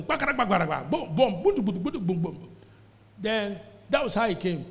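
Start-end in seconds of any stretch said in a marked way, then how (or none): 0.0-2.5 mouthed gunfire booms; imitated AK-47 fire. Preacher tells of a war-born baby, imitating gunfire. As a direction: rattle off bursts of booming gunfire. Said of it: "they heard A K forty-seven".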